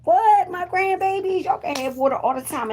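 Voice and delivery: high, gushing grandma voice; a doting grandmother spoiling the kids. Retold or imitated.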